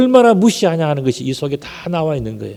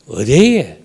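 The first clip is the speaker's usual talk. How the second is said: lilting Gyeongsang denial; Gyeongsang dialect; 4 dB louder, much slower than usual. Shown as a demonstration. Peter brushing off the accusation, Gyeongsang accent. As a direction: swoop up and down like a Gyeongsang local. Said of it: "경상도 버전을 하면 뭘까요?".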